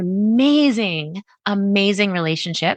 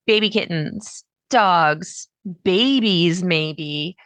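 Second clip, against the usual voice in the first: ordinary talk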